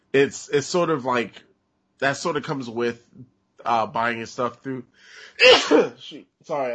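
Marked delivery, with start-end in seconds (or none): 5.4-5.9 loud sudden sneeze. Real, not acted. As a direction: sneeze into the mic. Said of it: "I just sneezed in everybody's ears".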